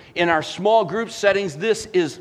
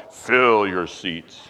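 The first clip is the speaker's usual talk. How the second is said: much lower, slow, mocking ad voice; mock slogan voice; 9 semitones lower, much slower than usual. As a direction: drag out the slogan like a cheesy ad. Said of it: "It says it right on the headline".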